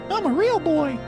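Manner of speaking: very high, loud puppet voice; Pinocchio voice. Pretend. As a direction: squeak it like a wooden puppet. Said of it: "I wanna be a real boy".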